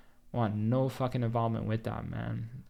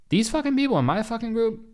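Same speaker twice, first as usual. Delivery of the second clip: hyped-up rant; 11 semitones higher, 6 dB louder than usual. Real, not acted. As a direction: pitch jumps way up, louder, fired-up. Hyped rant about his future leaders.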